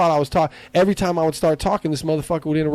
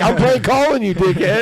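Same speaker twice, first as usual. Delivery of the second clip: mocking taunt; 7 semitones higher, 4 dB louder than usual. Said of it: "he's like".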